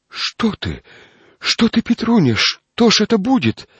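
high, loud frightened cry. Acted. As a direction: gasp it out in sudden fright. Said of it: "голосом, в котором слышался испуг".

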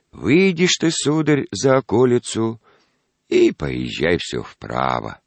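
put-on high, slow old man's voice. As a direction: ramble like an ancient grandfather. Old grandfather gives directions.

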